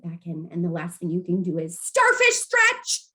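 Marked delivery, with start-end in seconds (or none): 1.8-3.1 high, loud call-out; playful yelled command. Shown as a demonstration. Caregiver giving the last distraction command, yelling. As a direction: belt out the silly command. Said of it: "you can call out these little commands".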